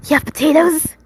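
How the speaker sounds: high, loud, excited question; childlike excitement. A narrator's reading. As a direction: ask with a child's wide-eyed excitement. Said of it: "it was excitement, and a child's excitement".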